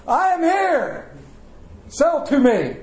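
high, slow, pompous proclamation; grand self-important announcement. Retold or imitated. The pastor striding into the farm store announcing himself to the staff. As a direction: announce yourself like royalty arriving.

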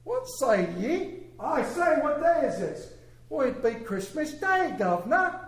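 high-pitched, hammed-up English accent. Preacher acting Scrooge calling to the boy, English accent. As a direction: play Dickens dialogue in an attempted English accent. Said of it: "let me try my English accent".

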